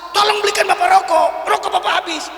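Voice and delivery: third, exasperated call from the boss, high-pitched; acted yelling. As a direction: yell the order out back, high. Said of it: "Teriak lagi nggak yang ketiga?".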